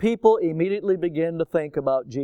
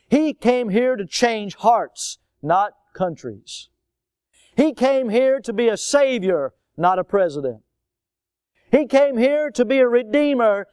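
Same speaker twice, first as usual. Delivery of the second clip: preaching at full force; 5 semitones higher, 3 dB louder than usual. Real, not acted. Pastor's climax on redeemer.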